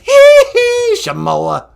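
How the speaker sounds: very high, loud falsetto vocal hiccups. Acted. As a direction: squeal a pop star's signature yelps. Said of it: "I'm the king of pop".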